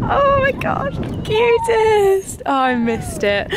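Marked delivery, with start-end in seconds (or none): 0.0-2.4 high gushing; high excited coo; delighted squeal. Real, not acted. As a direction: gush over the cute dog. Dog on agility.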